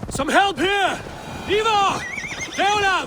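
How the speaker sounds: high, loud shouts for help; calling out. Acted. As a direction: yell urgently for help. Calling for help with wounded boy.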